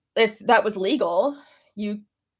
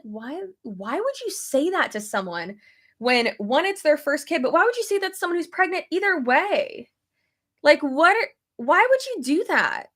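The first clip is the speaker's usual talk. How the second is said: incredulous, higher-pitched questioning; retold indignation; 6 semitones higher than usual. Husband reacting to a stranger's comment to pregnant wife, retold indignation. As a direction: protest it with swooping, disbelieving pitch.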